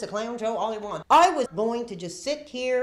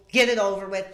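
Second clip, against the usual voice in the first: ordinary talk, nothing marked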